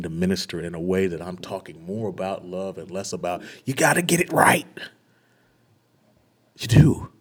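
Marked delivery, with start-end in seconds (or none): 3.6-7.1 much higher and louder, a parody of a scolding preacher; hammed-up legalistic sermon tone; mocking preachy voice. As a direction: send up the works-based preacher.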